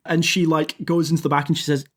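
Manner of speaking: usual speaking voice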